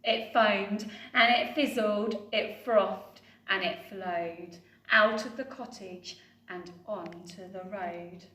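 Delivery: usual speaking voice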